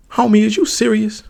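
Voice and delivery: high, loud disbelief; incredulous. Real, not acted. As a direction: react like he can't believe it. Incredulous reaction to mistake.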